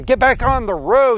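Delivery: loud, high acted command; angry order. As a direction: order the animal back, exasperated. Balaam orders donkey back while hitting it.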